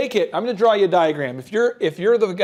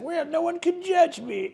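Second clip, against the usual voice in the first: high, soft mocking vendor voice; smug inner voice; 10 semitones higher, 5 dB quieter than usual. Chest-hair vendor thinking to himself, high smug mock. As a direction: sniff it out high and self-satisfied. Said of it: "he's like".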